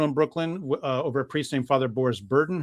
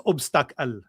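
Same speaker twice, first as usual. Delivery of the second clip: put-on "obstacle" in a thick Middle Eastern accent, stress moved onto the middle; slower than usual. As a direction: imitate a heavily accented "obstacle". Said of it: "really thick accent. He says".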